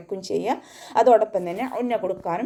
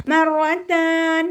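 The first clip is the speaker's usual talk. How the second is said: slow, high, drawn-out Quranic chant; measured tajweed recitation; 9 semitones higher, 6 dB louder, much slower than usual. Shown as a demonstration. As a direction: recite it in tajweed, stretching each sound. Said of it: "ഉയർത്തിയിട്ട് ഉച്ചരിക്കണം".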